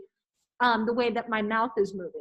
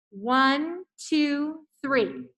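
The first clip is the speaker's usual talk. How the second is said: ordinary talk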